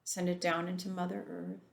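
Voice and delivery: ordinary delivery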